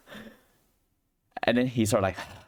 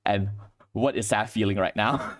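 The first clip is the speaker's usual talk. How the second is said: ordinary talk, nothing marked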